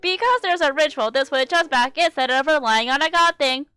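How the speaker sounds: loud, high, mechanical fake cheer; robotic forced cheer. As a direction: act relentlessly upbeat to block it out, ending in big fake laughs. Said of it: "talking in a robotic monotone voice, trying to forget".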